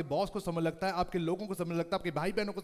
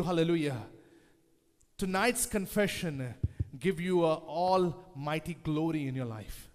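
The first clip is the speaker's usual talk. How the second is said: ordinary delivery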